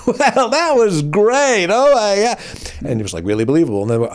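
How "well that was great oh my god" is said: high, slow, gushing mock Celine. Imitating [name] gushing after the take, high and slow. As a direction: gush it high and slow like a diva. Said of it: "She goes".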